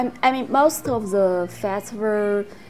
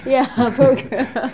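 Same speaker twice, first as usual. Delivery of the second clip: laughing; 3 semitones higher, 5 dB louder than usual. Real, not acted. Laughs at the friend's remark.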